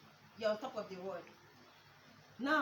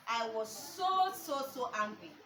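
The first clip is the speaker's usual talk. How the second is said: ordinary talk, nothing marked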